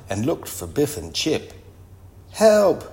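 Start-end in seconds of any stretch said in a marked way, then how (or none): 2.3-2.8 high, loud cry for help; called out. A narrator's reading. Kipper calls Biff and Chip for help, calling out. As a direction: call out sharply to children outside. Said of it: "he called".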